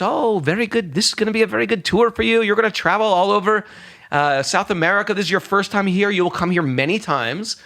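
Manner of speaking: high-pitched, upbeat fortune-teller voice; delighted palm reader. Retold or imitated. Yogini predicting a good tour to a visitor, delighted.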